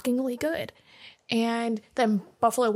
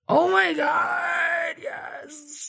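thrilled squeal, slow and stretched; excited shout; 6 semitones higher, much slower than usual. Real, not acted. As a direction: cheer like you just won. Excited about twenty-five dollars.

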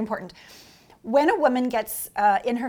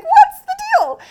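much higher and louder, indignant disbelief; baffled outrage; 13 dB louder than usual. Retold or imitated. Showgirl baffled by the leering men, shrill outrage. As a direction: shriek it in disbelief. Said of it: "and I'm like".

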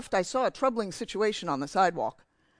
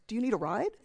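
mildly calm offer; 3 semitones higher than usual. Retold or imitated. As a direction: offer help gently, a little calmer. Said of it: "in a very calm voice".

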